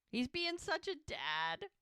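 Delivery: high, slow, melting coo; swooning, drawn-out admiration; touched gushing. Real, not acted. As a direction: gush fondly over the character. Teller gushing over tender scene.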